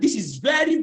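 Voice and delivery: ordinary talk, nothing marked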